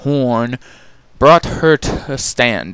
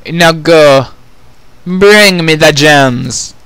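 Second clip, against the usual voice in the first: much louder, slow ruling command; commanding order; 6 semitones higher, 9 dB louder, much slower than usual. Acted. Queen ordering her daughters out, loud and slow. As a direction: send them off with authority.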